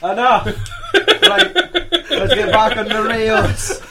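high, loud, slow laughing; laughing while reining it in. Real, not acted. Laughing, cutting off crude talk.

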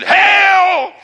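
loud, high, drawn-out "Hail!"; angel's hammed-up shouted greeting; booming hail. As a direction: startle him with a sudden high shout. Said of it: "tapped him on the shoulder and said".

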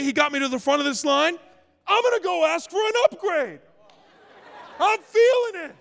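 high, swelling preacher bravado; hyped-up confidence. Acted. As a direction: ride the victory high, pitched well up. Acting out his swelling faith triumph.

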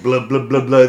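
mock Harley rumble; mock Harley engine noise; motorcycle engine noise. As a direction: do the engine noise with your mouth. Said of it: "they're both like".

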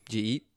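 "Did you eat" run together as "jeet"; Florida-style run-together "Did you eat"; mashed together. Shown as a demonstration. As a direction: slur "Did you eat" into one word. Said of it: "we just mash everything together".

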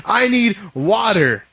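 high, strained voice, slowing at the end; high-pitched pleading. Retold or imitated. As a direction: squeak it out, desperate. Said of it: "people are like".

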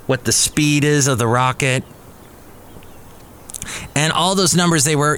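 usual speaking voice

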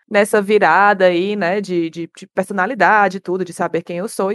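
ordinary delivery

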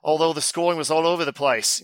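put-on Australian accent; bad Australian accent. Host reading listener's quiz email, bad Australian accent. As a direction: read it in a hammy Australian voice. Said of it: "Maybe I'll do my Battle Strand accent".

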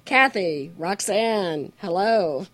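ordinary talk

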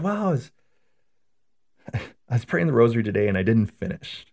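put-on high, hesitant old lady voice with a little laugh; grandmother confessing. Grandmother admitting to her priest she didn't finish the rosary, high and sheepish. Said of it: "She said".

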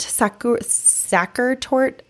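usual speaking voice

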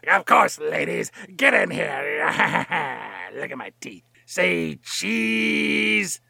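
a hint of a creepy villain, posing for a photo; lightly voiced creepy villain. Villain answers fans.

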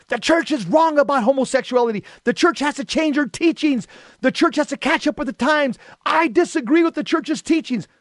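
high-pitched angry tirade, retold. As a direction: rail in protest, pitch held high. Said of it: "Father John said very angrily".

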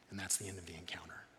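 whispered softly; breathy whisper to close the story. Real, not acted. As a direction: hush it like a secret ending.

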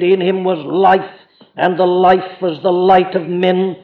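slow, measured reading of holy text; solemn gospel reading from the pulpit; scripture reading. Acted. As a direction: intone the verses with reverence. Reads John's prologue aloud.

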